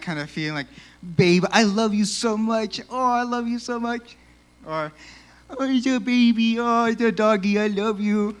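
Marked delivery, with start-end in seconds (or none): 1.2-4.2 hammed-up lovestruck cooing; gushing lovey-dovey. Acting out mushy love feeling. 5.5-8.4 high, loud mock baby talk; hammed-up doting pet voice; gushing baby talk. Acting out cooing over a pet.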